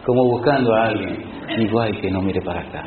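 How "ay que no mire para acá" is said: low, soft worried murmur under the breath; hope not to be seen, hushed and low; nervous hushed plea. Retold or imitated. Hiding from the pastor in the car. (súplica nerviosa en voz baja y grave)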